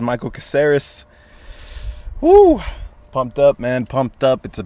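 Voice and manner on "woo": high loud whoop; excited whoop. Real, not acted. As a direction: let out a big hype cheer. Hype podcast opener.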